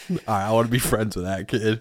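usual speaking voice

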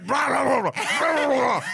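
loud growling demon voice. Retold or imitated. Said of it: "It's like a demon growl".

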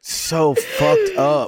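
high, slow laughing groan. Real, not acted. Disgusted aftermath.

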